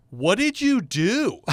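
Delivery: high, slow, loud outraged wife; shocked wife. Retold or imitated. Wife seeing the surprise Lexus, shocked and shrill-high. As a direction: play the stunned wife, pitch up. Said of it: "And the wife is like".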